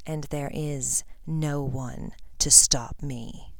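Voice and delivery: soft, measured, gloating menace; quiet villain savouring his triumph; cold villain voice. A narrator's reading. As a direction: speak with cold, unhurried control. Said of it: "His cold voice pierced".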